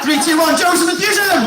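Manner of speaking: host demanding cheers from the crowd; countdown shout. Real, not acted. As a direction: count down and explode on the last word.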